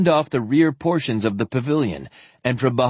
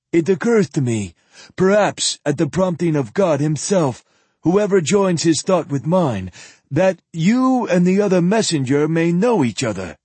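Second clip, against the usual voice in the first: put-on higher, louder old priest voice; old zealot voice; 6 semitones higher, 3 dB louder than usual. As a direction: preach it like a pampered old zealot.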